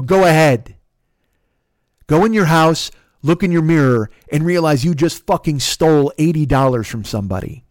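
forceful angry orders; angry scolding. Retold or imitated. Driver sending thieving passenger home, angry scolding. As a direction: dare and shame the listener.

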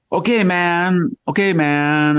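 put-on high, slow, drawn-out Valley Girl voice; Valley Girl impression. Imitating the Chinese consul for the host, high hammed-up Valley Girl voice. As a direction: pitch way up and stretch out the okay like a Valley Girl. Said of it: "want to hear you imitate her".

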